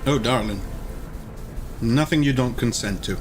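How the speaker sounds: ordinary talk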